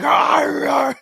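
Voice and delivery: very high shouted mock roar; imitated roaring. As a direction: yell it like a fan barking at the crowd. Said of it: "and he's just like".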